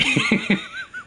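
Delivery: high-pitched giggle; laughing. Real, not acted. Streamer laughing at his viewer count, high giggle. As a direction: squeal with laughter.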